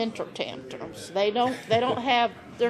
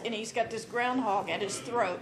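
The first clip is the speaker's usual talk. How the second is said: ordinary delivery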